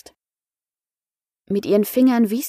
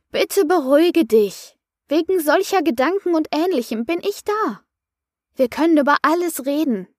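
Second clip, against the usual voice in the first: lightly voiced coach talking someone down; calming coach; 7 semitones higher than usual. A narrator's reading. Coach intervenes to calm the upset man, soothing. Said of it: "Erneut ging ihr Coach dazwischen".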